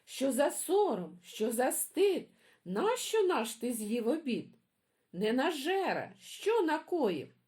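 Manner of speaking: chicks shouting. Acted. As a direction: yell at the greedy brother like upset chicks. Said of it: "почали гуртом кричати".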